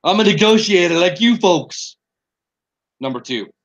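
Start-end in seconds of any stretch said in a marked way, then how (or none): 0.0-2.0 lightly voiced politician impression; a bit of a put-on president; mock presidential voice. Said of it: "we should do an impersonation game".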